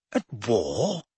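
a touch of nervous disbelief; quietly unsettled question. A narrator's reading. Prime Minister hearing of war, mildly nervous. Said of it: "repeated the Prime Minister nervously".

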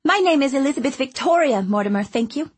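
put-on high, eager chatty girl; bubbly little girl rushing her words; young girl voice. A narrator's reading. Girl insists on full name.

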